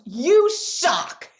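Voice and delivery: a mild mock yell of a furious three-year-old; retold toddler scream. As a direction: imitate the child's shout, only lightly. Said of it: "screams at the top of her lungs".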